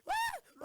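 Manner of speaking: loud, very high shriek of fright; terrified scream. Acted. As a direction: scream as the shell hits. Under attack, screaming.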